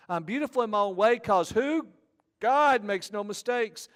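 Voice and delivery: high, loud declaiming; emphatic lyric recital. Retold or imitated. Preacher quotes the chorus to the congregation, loud and emphatic. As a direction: proclaim the chorus, slowing into the second half.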